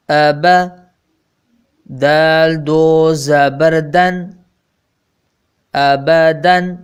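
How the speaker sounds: measured, drawn-out spelling recital; slow syllable drill. Shown as a demonstration. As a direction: spell each letter out slowly for pupils. (धीमा तहज्जी वाला पाठ)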